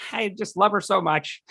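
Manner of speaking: lightly voiced besotted boyfriend; lovestruck boyfriend. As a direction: swoon it lightly. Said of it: "her boyfriend was like".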